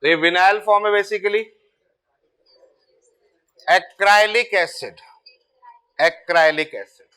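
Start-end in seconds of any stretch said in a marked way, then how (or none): 3.7-7.1 acrylic acid drawn out slowly; slow, deliberate naming for students; slow dictation. Shown as a demonstration. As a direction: repeat it slowly so the class can note it. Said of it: "teacher repeating name for class".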